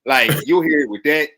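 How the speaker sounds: ordinary delivery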